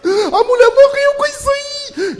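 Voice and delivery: very high, slow, shouted wail; scared boy shouting at the doctor. Retold or imitated. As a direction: howl it in mock despair. Kid wailing to doctor.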